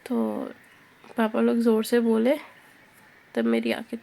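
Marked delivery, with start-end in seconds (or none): none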